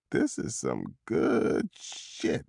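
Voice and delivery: very slow, low, soft slur; blissed-out, dragging every word; drugged suspect. A narrator's reading. Grinning drugged suspect looking at his wound, dazed slow murmur.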